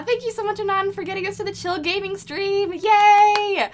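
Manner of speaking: high, loud celebratory thanks; excited cheer. Real, not acted. Streamer cheering the donor who reached the goal, high and loud.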